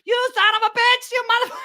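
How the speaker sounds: very high, loud imitation of her cursing; hammed-up angry woman yelling; mock cursing woman. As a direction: squeal it like the angry dancer. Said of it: "you could hear her cursing".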